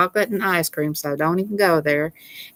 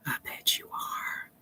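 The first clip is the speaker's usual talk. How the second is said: whisper; 16 dB quieter than usual. Real, not acted. Teasing Raymond about being married.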